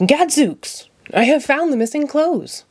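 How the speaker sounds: Gadzooks cried up high; proud detective thinking he solved it; hammed-up eureka. A narrator's reading. Sherlock triumphant.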